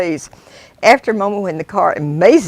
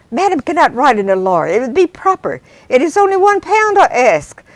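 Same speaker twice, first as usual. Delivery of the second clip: driver pleading; 7 semitones higher, 4 dB louder than usual. Acted. Driver appeals to Obie.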